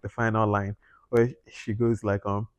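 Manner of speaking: ordinary delivery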